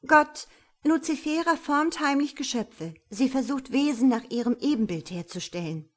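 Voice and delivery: steady, determined accusation; firm voice. Acted. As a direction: denounce her firmly. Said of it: "sagt sie mit fester Stimme".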